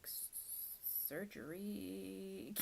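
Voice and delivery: slow, halting, disbelieving repeat; slow stunned echo of the word; stunned disbelief. Retold or imitated. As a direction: stammer it out like you can't take it in. Stunned by the surgery news.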